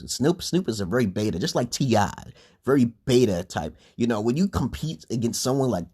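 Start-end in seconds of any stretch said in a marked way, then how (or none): none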